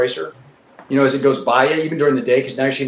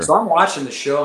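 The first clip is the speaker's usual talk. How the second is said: ordinary talk, nothing marked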